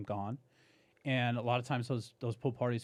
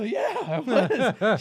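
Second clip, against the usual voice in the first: slow, louder laughing admission; 9 semitones higher, 9 dB louder, much slower than usual. Retold or imitated. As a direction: drag it out through a laugh. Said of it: "I go".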